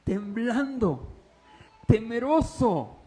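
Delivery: slow, weighted; slow fearful words. Shown as a demonstration. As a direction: paint Saul's fear. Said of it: "Cómo te imaginas ese Saulo". (palabras lentas y temerosas)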